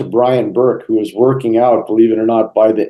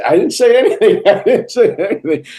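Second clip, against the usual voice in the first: high-pitched mock denial with a laugh; amused protest; 11 semitones higher than usual. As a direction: protest innocence through giggles. Amused retelling of his defensive denial.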